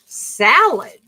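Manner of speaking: usual speaking voice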